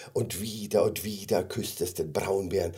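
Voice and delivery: ordinary delivery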